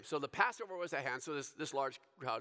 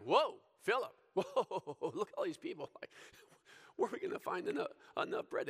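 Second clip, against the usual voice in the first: laughing tease; 5 semitones higher than usual. Retold or imitated. Jesus teasing Philip, playful.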